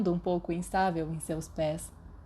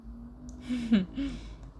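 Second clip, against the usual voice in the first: teasing laugh; 5 semitones higher, 4 dB quieter than usual. Acted. Mary laughs at his reaction.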